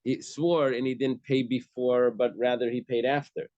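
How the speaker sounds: read as one thing. Shown as a demonstration. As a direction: draw it out as one connected phrase. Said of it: "you have to read the whole thing together".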